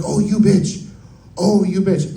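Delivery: high, retold betrayed outrage; indignant disbelief. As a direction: pitch it up, mock-offended at the betrayal. Outrage at mom sabotaging him.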